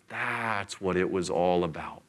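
slow, low voice of sudden understanding; dawning realization. Retold or imitated. Disciples as Jesus unlocks the scriptures, dawning realization. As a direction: draw it out as it sinks in.

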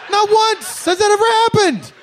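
indignant high protest. Real, not acted.

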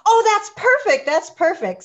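high, loud gushing approval; imitated delight. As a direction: gush as if imitating thrilled colleagues. Acting out delighted show makers' reply.

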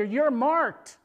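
ringing, confident assertion; bold declaration. Real, not acted. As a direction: declare it boldly, high and loud. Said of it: "I can say that so boldly".

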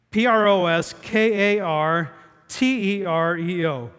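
P R O S K A R T E R E O letter by letter; spelling out letters. Shown as a demonstration. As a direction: spell it out, each letter separate. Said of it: "P R O S, you can write this down".

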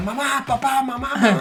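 llamando a mamá y papá en voz alta y aguda; grito de niño asustado, más agudo; calling for parents. Retold or imitated. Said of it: "empecé a gritar". (grito imitado llamando a sus padres)

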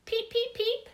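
tiny squeaky chick peeps, very high; teeny peeps. A narrator's reading. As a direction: chirp like a baby bird. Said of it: "It was Lucille".

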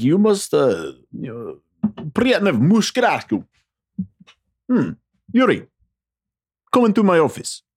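high, hammed-up Russian accent; terrible fake Russian accent. Soviet boss summoning Yuri, fake Russian accent. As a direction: play a cartoon Russian boss with bad accent. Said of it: "That's a terrible fake Russian accent".

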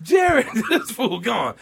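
calling his name in a hammed-up panic; retold scream. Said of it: "I'm screaming".

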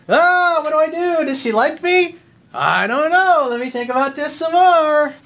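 high, loud, whiny mock of a hesitant guy; mocking a dithering guy. Coach mocking a hesitant follower, high whiny dither. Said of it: "and what does he do?".

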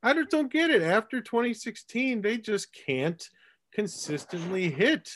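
high-pitched, baffled exasperation; flabbergasted. Real, not acted. Podcast host venting about his team's hitting, flabbergasted. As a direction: throw up your hands in bafflement, pitch climbing. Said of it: "I'm just flabbergasted".

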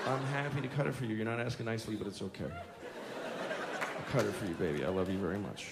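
very soft, low, subdued reply; hushed, weary parental patience; placating dad. Retold or imitated. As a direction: answer the tyrant meekly and gently.

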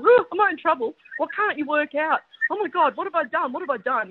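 high-pitched rookie flap, easing at the end; hammed-up worried self-questioning; mock panic. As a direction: act out a new teacher fretting. Voices her panicking younger self.

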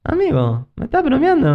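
lightly voiced incredulous friend, pitch jumping up; mock disbelief in the friend's voice; imitating his friend. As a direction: friend teasing him, half-imitated. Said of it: "Así, él habla".

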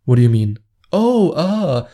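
feigned confusion after a slip; mock innocence. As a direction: act oh so innocent, as if nothing was said. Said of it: "pretend to be oh so innocent".

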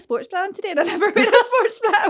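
high, loud and laughing. Real, not acted. Giggling over the bra swap.

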